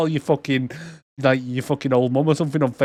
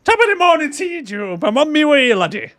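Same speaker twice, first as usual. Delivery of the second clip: a jolly fake Celtic accent; mock Irish-Scottish accent; 12 semitones higher than usual. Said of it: "I don't know why he's half Scottish".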